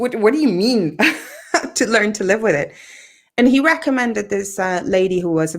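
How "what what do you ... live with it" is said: high, swooping disbelief with a laugh; incredulous. Retold or imitated. Patient retelling her protest to the doctor, incredulous. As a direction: protest in amused outrage.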